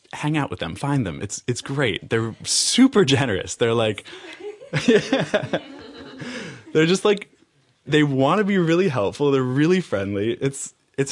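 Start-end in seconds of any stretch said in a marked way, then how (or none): 4.1-6.7 long high-pitched laugh; high laughing outburst. Real, not acted. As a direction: burst into a high, drawn-out laugh. Imitated or amused laugh mid-description.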